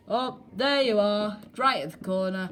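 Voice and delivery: ordinary delivery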